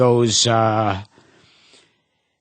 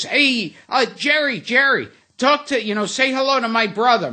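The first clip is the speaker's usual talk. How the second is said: higher-pitched mock call for attention; 8 semitones higher, faster than usual. Woman on payphone hailing Jerry, calling out. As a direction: imitate a woman hailing someone she spots.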